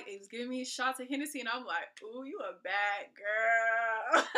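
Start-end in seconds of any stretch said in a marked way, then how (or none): 2.0-4.4 sassy self-hype. Retold or imitated. Taking shots from the guy, slow sassy self-tease.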